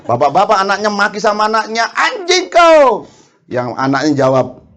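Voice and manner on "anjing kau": put-on high, very slow father's curse at his child; mock angry shout. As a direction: stretch the curse out in a hammed-up rage. Ayah memaki anaknya. (ayah memaki anak dengan marah dibuat-buat, tinggi dan sangat lambat)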